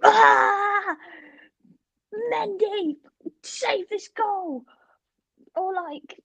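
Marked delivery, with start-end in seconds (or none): 0.0-4.6 high, drawn-out frustrated cry at the game; mock gamer outburst. FIFA player yelling at footballers mid-match, mock outburst. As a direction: wail it at the screen, stretched out. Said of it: "and then they just go".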